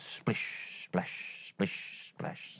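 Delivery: soft whispered splish splash; whispered wet footsteps; whispered sound effect. A narrator's reading. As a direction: hush the splishes.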